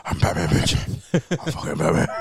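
very low, rasping superhero parody; Batman. Retold or imitated. Friend copying what every kid does, deep Batman impression. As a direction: drop deep and drag it out voicelessly. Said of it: "every kid has ever done that for Batman".

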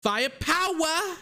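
hammed-up Boston accent, "Firepower!" high and drawn out with the r dropped, faɪəpʰaʊə. Shown as a demonstration. Podcaster redoing a football word in a Boston accent. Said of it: "let me say it like I'm from Boston".

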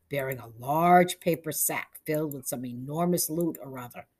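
plain reading voice

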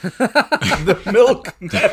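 slow, high laughing words. Real, not acted. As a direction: giggle through the phrase. Laughing at the image.

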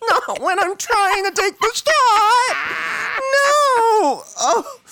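high wailing laugh; laughing-crying. Real, not acted. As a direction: cry out through laughter. Cat hair in the drink.

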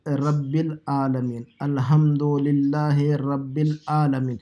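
"العالمین" slow, opening on a plain long a. Shown as a demonstration. Teacher showing learners the common reading, plain a in "العالمین". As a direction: recite "رب العالمین" slowly, Indo-Pakistani style, without the throat sound. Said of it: "Indian style میں یا Pakistani style میں".

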